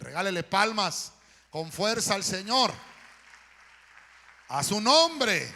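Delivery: loud preacher's call, stretched at the end; rallying shout. Real, not acted. Preacher urging the congregation to applaud the Lord, loud rallying shout. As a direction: rouse the crowd at full voice.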